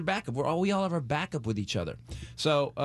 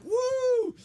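ecstatic woo. Retold or imitated. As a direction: whoop like you're floating away. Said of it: "I was like".

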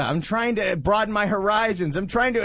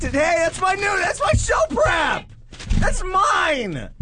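indignant shout; 12 semitones higher, slower than usual. Real, not acted. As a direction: yell that it's your story. Co-host takes his news stories.

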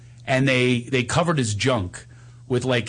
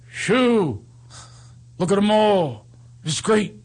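high, halting bad-actor delivery; unnatural line reading; 7 semitones higher, slower than usual. Panicked amateur actor on his first take, stilted recital. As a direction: recite it in stiff, separate chunks. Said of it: "I don't talk like this".

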